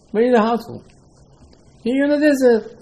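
high-pitched mock exasperation; incredulous acted telling-off, high voice; exasperated scolding. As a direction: argue back in disbelief, pitch jumping up. Acted arguing with desert complainers.

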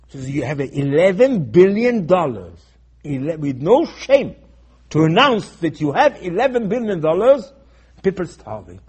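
loud, emphatic sermon delivery; impassioned preaching. Real, not acted. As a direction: preach it out loud, driving home the key words. Preaches indignantly, raising voice.

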